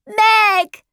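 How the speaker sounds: put-on high child's cry of joy. A narrator's reading. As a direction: call out her name high and excited. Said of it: "he cried".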